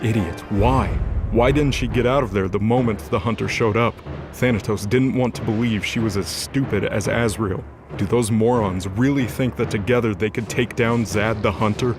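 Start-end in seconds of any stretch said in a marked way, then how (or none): none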